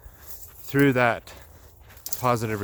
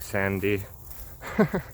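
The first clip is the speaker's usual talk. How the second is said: ordinary talk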